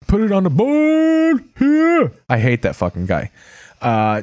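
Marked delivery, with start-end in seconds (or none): 0.1-2.1 high, slow announcer catchphrase; Hawk Harrelson. Retold or imitated. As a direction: belt it like a TV baseball announcer. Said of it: "I hate Hawk Harrelson".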